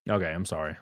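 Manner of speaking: low, soft climb-down; small, deflated sorry; sheepish apology. Real, not acted. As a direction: back off quietly after ranting. Sheepish apology after the tirade.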